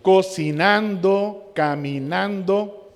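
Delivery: drawn-out -ando words; teacher slowly giving examples of gerunds. Shown as a demonstration. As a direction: say each word slowly to the class. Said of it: "Cuando usamos el ando".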